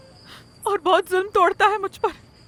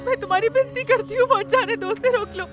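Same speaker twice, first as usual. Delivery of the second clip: high, plaintive begging; wailing mother's entreaty; tearful pleading; 4 semitones higher than usual. Acted. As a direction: beseech him as if about to break down. Mother begs husband to stop son.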